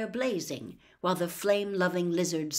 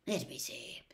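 softer, breathy thinking aloud; musing whisper; 11 dB quieter than usual. Acted. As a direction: murmur it to yourself. Lupin musing to himself.

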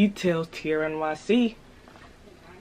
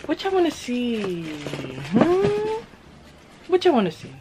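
teasing high voice; 6 semitones higher than usual. Acted. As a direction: tease them in a high voice. Teasing the audience.

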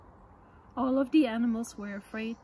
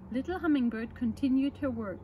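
ordinary delivery, nothing marked

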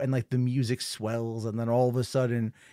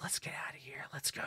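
acted hushed whisper; very soft, voiceless urging to escape; urgent whisper; 16 dB quieter than usual. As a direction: whisper it like you're fleeing unseen. Acted soldiers hiding from troll.

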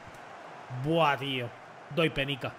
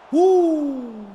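surprised shout; 6 semitones higher, 7 dB louder than usual. Real, not acted. Shot flies like a rocket.

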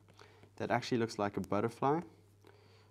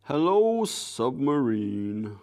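playful greeting; 2 semitones higher, 5 dB louder, much slower than usual. Pretend. Artist greeting the submarine being drawn, playful loud call. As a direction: hail it grandly, stretching the last word.

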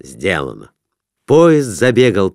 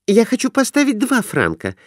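put-on high, girlish voice; eager wife asking to place a bet; young wife's voice; 12 semitones higher than usual. A narrator's reading. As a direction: voice the wife much higher. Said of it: "сказала она".

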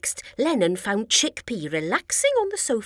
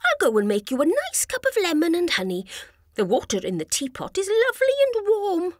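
quick cheerful voice; 7 semitones higher, faster than usual. A narrator's reading. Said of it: "he said".

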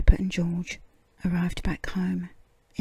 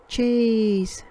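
higher, louder call of cheese; loud and bright; 4 semitones higher, 5 dB louder than usual. Acted. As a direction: announce it proudly. Peppa hands over cheese.

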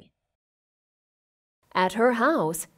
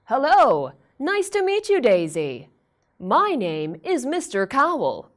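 cheerful owl teacher; 2 semitones higher, 6 dB louder than usual. Acted. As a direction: greet her with a big smile. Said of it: "he said with a big smile".

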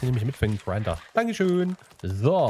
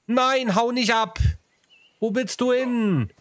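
high, slow, coaxing plea to a runaway pet; pleading baby talk; 9 semitones higher, slower than usual. Pretend. Player begging the fleeing creature not to run, high slow plea. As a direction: beg the animal to stay like a child.

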